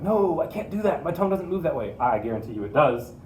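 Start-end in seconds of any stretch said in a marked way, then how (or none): 0.0-1.9 high-pitched mock protest; imitated whining student. Teacher imitating a student who can't do it, mock whine. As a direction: put on a higher, helpless complaining voice. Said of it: "if you're like".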